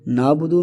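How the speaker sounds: "نابدو" with long a and no ain; naa-budu for "نابدو"; missing ain. Shown as a demonstration. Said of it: "عین ظاہر نہیں ہو رہا". ("نابدو" عین کے بغیر)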